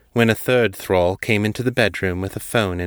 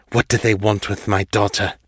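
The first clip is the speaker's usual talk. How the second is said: ordinary delivery, nothing marked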